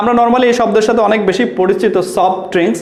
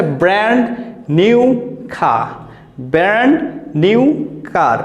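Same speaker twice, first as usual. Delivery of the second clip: slow demo; slower than usual. Shown as a demonstration. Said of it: "এখানে লেখা আছে".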